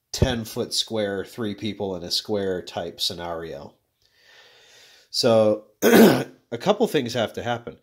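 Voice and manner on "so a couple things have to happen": coughing between words; throat-clearing coughs breaking speech; coughing fit. Real, not acted. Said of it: "Sorry, I'm coughing so much".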